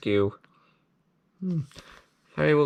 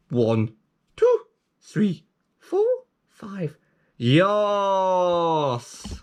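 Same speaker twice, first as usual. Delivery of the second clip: excited counting; 6 semitones higher, much slower than usual. Pretend. Counting to five, cheering.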